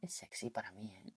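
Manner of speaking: soft, teasing whisper; playful whisper. Real, not acted. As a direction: whisper it like a little secret. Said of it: "que es hasta un poquito ronquita".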